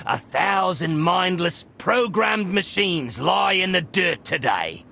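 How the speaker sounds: high, grandiose mock praise for the fallen; mocking eulogy. Droid officer mocking the dead Mandalorians, high mock grandeur. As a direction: lay it on thick, a sneering tribute. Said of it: "No point mocking them".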